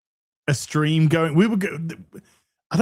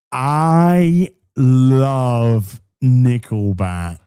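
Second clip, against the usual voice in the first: very slow, loud, stretched words; low, booming, hugely drawn-out proclamation; exaggerated declaration; 4 semitones lower, 7 dB louder, much slower than usual. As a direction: drag every word out at full voice. Repeats declaration even more drawn out.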